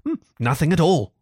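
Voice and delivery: lightly voiced, decided Rat; firm reply. A narrator's reading. Rat answering Mole about Toad, mildly firm. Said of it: "replied the rat firmly".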